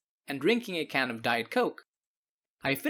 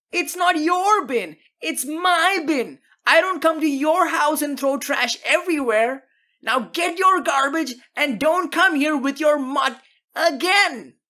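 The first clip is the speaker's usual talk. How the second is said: furious shouting; 10 semitones higher, 7 dB louder than usual. Acted. Homeowner screaming at teen to take his trash, furious. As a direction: lose it completely over a soda can. Neighbor's final tirade.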